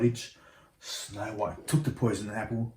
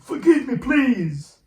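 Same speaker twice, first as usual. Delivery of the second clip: far higher, louder frantic plea; begging; 8 dB louder, slower than usual. Acted. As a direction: beg even harder, voice climbing. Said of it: "he begged".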